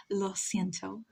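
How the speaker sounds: usual speaking voice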